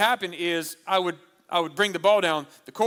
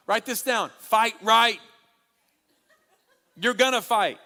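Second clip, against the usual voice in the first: usual speaking voice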